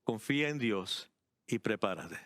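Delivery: measured, with a clear pause before the last phrase; deliberately paced. Real, not acted. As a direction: say it unhurriedly, in separate phrases. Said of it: "esto lo voy a decir pausadamente".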